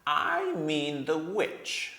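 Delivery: slow, each word set apart; deliberate, measured dwarf's voice; very slowly and distinctly. A narrator's reading. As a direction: lay every word down slowly and clearly. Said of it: "very slowly and distinctly".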